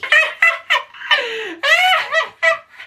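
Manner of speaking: high squealing burst of laughter; shrieking laugh. Real, not acted. Laughing at a queen falling.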